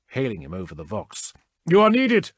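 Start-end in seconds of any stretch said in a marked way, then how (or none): 1.7-2.3 loud, much higher urgent call. A narrator's reading. Krask summoning the chaplain over the vox, urgent shout.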